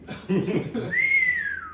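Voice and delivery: a squealing burst of laughter; delighted high laugh; high-pitched laugh. Real, not acted. Laughter after the punchline.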